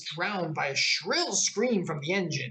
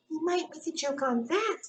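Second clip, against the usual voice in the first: much higher, tiny insect voice; tiny gnat voice; 13 semitones higher than usual. A narrator's reading. Gnat whispering a joke into Alice's ear, tiny and high. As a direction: squeak it close in her ear. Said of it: "said the little voice".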